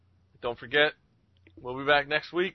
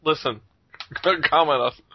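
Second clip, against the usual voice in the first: normal talking voice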